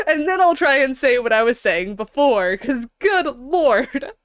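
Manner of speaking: high giggly laughing words; cracking up, can't stop; breaking character giggling. Real, not acted. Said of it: "can't keep a straight face".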